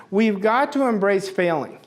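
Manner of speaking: usual speaking voice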